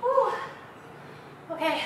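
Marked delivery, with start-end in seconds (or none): 0.0-0.3 high cheering woo; energetic coach whoop; excited whoop. Real, not acted.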